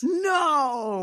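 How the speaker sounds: high, drawn-out no; astonished disbelief. Real, not acted. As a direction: stretch it out in disbelief. Reacting to surprising casting news.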